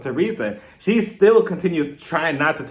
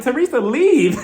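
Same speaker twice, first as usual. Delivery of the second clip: laughing leave; 9 semitones higher, 3 dB louder than usual. Real, not acted. Telling Teresa to go, laughing.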